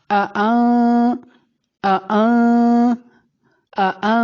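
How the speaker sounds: short آ then a long nasal آ; ikhfa. Shown as a demonstration. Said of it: "یہ اخفا ہے". (آ، آ ناک میں چھپا کر، نرمی سے)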